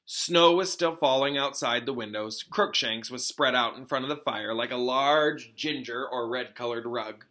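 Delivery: plain reading voice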